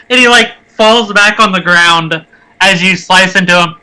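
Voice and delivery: ordinary delivery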